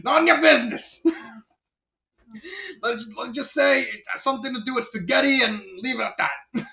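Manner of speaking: high, loud Brooklyn cartoon voice; Brooklyn accent. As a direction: brush it off like cartoon Mario from Brooklyn. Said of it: "cartoon Mario with the exaggerated Brooklyn accent".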